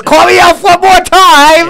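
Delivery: high, loud, slow menacing elf; angry elf threat. Retold or imitated. Retelling the angry elf scene, high loud slow threat. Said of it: "And he goes".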